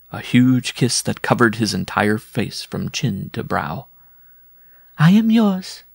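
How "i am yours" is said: very high, loud bride voice; high lovestruck bride; amorous bride. A narrator's reading. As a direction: declare love in a high-pitched put-on voice. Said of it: "she muttered".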